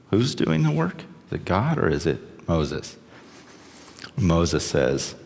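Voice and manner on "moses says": ordinary talk, nothing marked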